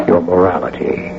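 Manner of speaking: ordinary talk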